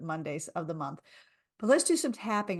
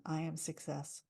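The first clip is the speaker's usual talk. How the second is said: softer, calm affirmation; 3 semitones lower, 7 dB quieter than usual. Shown as a demonstration. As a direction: say it gently. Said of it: "repeat after me and listen".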